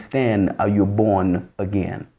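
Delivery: God quietly questioning him from within; inner voice of God. Retold or imitated. God's inner voice speaking.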